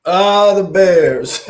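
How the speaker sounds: drawn-out teasing "Ah, the Bears"; slow, loud, mocking sigh; mock dismissive. Real, not acted.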